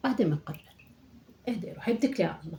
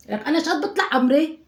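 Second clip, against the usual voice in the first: indignant exclaim; 6 semitones higher, 6 dB louder than usual. Acted. Indignant about her age.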